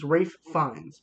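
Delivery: "Ralph Fiennes" said slowly as RAFE FINES; "Ralph" rhymes with safe, "Fiennes" with lines; careful name pronunciation. Shown as a demonstration. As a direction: say "Ralph Fiennes" as rayf fynz, unhurried. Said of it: "it's hard to pronounce".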